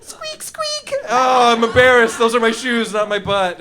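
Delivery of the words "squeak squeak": high squeaky shoe noises; imitated shoe squeak, high and thin. Shown as a demonstration. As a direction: squeak like sneakers on a floor. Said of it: "Squeaky shoes".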